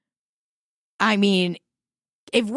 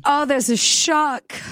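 high, slow mid-Atlantic dismay; mock mid-Atlantic accent; 7 semitones higher, slower than usual. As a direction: draw it out in old-film mid-Atlantic alarm. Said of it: "they were like".

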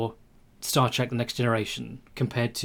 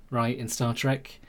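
ordinary talk

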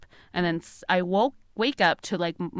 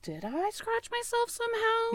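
much higher, softer puzzled question; doubting herself; 9 dB quieter than usual. Retold or imitated. Girl questioning herself about the scratch. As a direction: wonder aloud as if unsure of yourself. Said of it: "I used to just be like".

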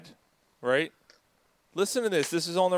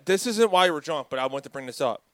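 ordinary talk

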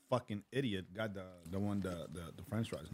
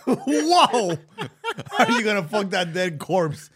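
laughing; 11 semitones higher, 15 dB louder, slower than usual. Real, not acted. Repeating joke amid laughter.

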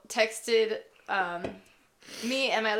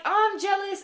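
excited; 10 semitones higher than usual. Retold or imitated. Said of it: "we were like".

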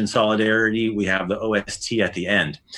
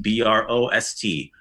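ordinary delivery

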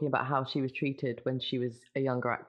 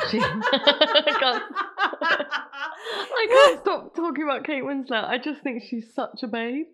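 high-pitched, helpless laughing while talking; gushing through a laughing fit; 12 semitones higher, 6 dB louder, slower than usual. Real, not acted. Giggling fit over favourite actress.

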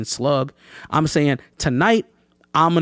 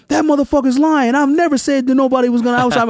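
high-pitched mock protest; retold indignant denial; 9 semitones higher, 3 dB louder than usual. Rapper denies it to the crowd, high indignant imitation. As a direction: imitate someone protesting from the stage.

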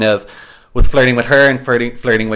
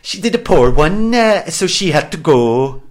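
bad Welsh accent, pitched up; 5 semitones higher than usual. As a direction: repeat it in a weak Welsh lilt. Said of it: "my Welsh accent is steadily getting worse".

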